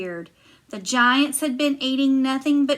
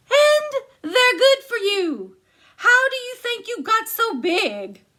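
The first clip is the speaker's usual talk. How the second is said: much higher, loud shrill-free insisting wife; high giantess; 11 semitones higher, 4 dB louder than usual. Acted. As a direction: push it up high and bright.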